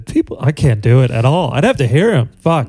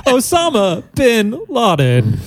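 Christopher Walken impression; 7 semitones higher than usual. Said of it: "Christopher Walken".

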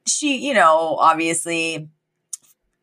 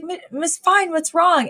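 mock concern; 10 semitones higher than usual. Acts concerned Mister Sheffield.

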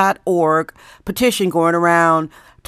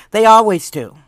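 ordinary talk, nothing marked

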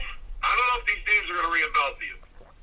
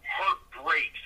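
ordinary delivery

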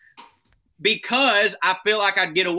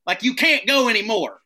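higher, louder yell; mock screaming roommate; 8 semitones higher, 6 dB louder than usual. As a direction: bust in and yell at them. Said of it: "Busts in the door screaming".

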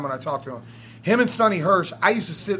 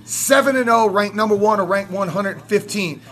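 raised, forceful voice full of defiance; heated rant; 3 semitones higher than usual. Real, not acted. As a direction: rant hotly about the rival. Heated rant about the rivalry.

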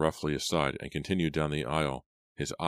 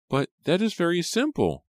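put-on very high, louder little-girl voice; Rachel interrupting; 14 semitones higher, 5 dB louder than usual. A narrator's reading. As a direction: pipe up eagerly as a small girl. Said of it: "Rachel interrupted".

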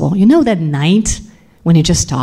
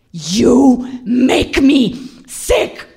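high, drawn-out words, sick hissed out; furious disgust; 7 semitones higher, much slower than usual. Retold or imitated. Husband revolted by his wife, furious disgust. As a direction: spit it out slowly in disgust.

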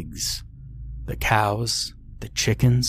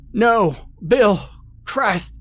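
anguished yell, higher and louder; 12 semitones higher, 3 dB louder, slower than usual. Acted. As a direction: cry out in horror at a friend's body. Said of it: "he yelled as he ran over".

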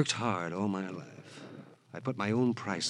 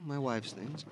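usual speaking voice

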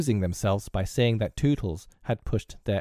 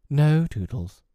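gentle refusal; 5 semitones higher, 5 dB louder, slower than usual. A narrator's reading. Wendy refuses Tootles.

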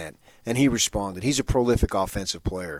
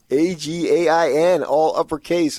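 loud spelled-out letters; 8 semitones higher, 8 dB louder than usual. Acted. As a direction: spell each letter loudly. Spelling out the all-caps word.

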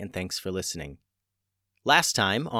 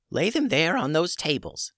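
lightly voiced brisk old witch; a hint of a no-nonsense witch; 7 semitones higher than usual. A narrator's reading. Old witch Tubes speaks.

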